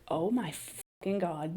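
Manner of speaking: imitated hushed amazement; hushed awe. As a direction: say it like you can barely believe it. Polly gushes over her portrait.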